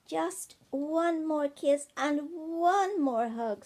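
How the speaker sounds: slow, high, loud plea; pleading child. A narrator's reading. Ben begs Mummy Bear at bedtime, pleading loudly. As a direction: draw out the begging.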